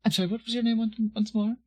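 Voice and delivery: lilting, high flirtatious bard; airy bard voice. Acted. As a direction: toss the question up lightly. Bard asks name, high.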